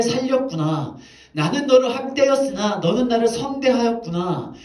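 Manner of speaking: Saul wailing. Retold or imitated. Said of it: "통곡하면서 다윗에게 울".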